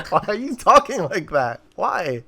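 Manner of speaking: high-pitched, incredulous questioning; retold bafflement, pitched well up; baffled mock protest. Friend retelling his reaction to a silly movie line, mock baffled.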